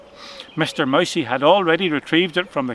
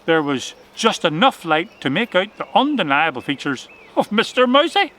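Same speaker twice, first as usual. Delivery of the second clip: plain reading voice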